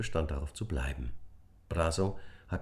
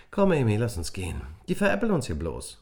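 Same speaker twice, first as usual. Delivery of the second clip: louder, higher irritated brush-off; annoyed girl; 4 semitones higher, 6 dB louder, faster than usual. A narrator's reading. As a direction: storm off, fed up.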